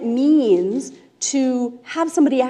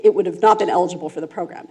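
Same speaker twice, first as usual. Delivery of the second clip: normal talking voice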